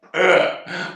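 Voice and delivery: loud, high burst of laughing; real laughter. Real, not acted. Man laughing at his own street story, loud cackle. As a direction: crack up loudly.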